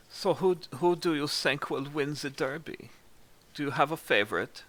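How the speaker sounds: put-on amateur German accent with polite enthusiasm. Game master as Reese discussing horse odds, German accent. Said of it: "My, my German accent sucks too".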